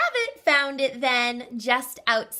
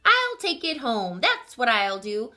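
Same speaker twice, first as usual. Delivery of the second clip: proud little bear; bear cub voice; 3 semitones higher than usual. Acted.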